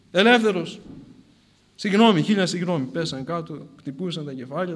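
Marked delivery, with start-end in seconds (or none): 0.1-2.8 louder, impassioned begging for pardon; urgent apology. Acted. Soldiers falling down before the saint, urgent apology. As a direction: plead forgiveness in a rush of feeling.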